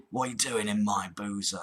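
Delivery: low, full-on Cockney accent; Cockney geezer. Shown as a demonstration. Caller warming up his accent before dialling, Cockney. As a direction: do a pub-going Cockney geezer. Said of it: "full-on Cockney geezer".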